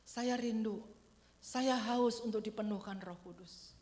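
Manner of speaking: slow, deliberate confession of longing for the Spirit; slow spoken declaration. Shown as a demonstration. Preacher modelling the congregation's prayer, slow and deliberate. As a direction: say each word slowly and firmly.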